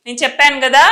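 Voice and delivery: usual speaking voice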